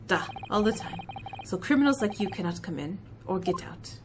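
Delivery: put-on a proud Russian crewman, heavy accent; Russian seaman introducing himself, low and gruff; Stroganoff, Russian accent.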